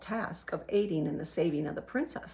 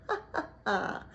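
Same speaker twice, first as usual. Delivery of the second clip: sinister chuckle. A narrator's reading. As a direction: chuckle with menace. Rat laughs.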